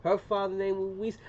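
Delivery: ordinary talk, nothing marked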